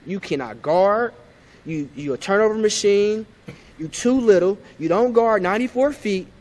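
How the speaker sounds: a touch of the coach scolding him; quoting coach. Retold or imitated. Player retells coach calling him a turnover machine, mildly stern. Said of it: "He said".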